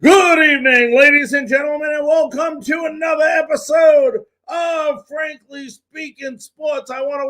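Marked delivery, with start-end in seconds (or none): none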